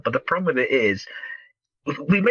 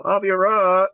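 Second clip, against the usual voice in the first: plaintive higher-pitched call; phone caller Avi Arad; 9 semitones higher, 4 dB louder than usual. Acted. Podcaster mimics producer phoning a studio boss, whiny caller. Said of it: "Avi Arad calls up Amy Pascal".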